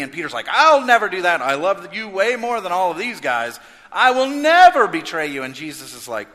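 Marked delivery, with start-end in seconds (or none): 0.5-5.4 high, loud protest; loud vow. Retold or imitated. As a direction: swear it like your honour depends on it. Peter boasting to Jesus.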